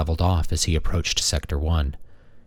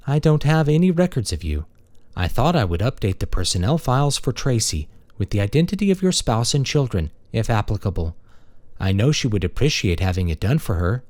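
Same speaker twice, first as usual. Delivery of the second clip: higher, polite feminine robot; a courteous maintenance machine; friendly robot; 5 semitones higher than usual. Acted. Robot character speaking.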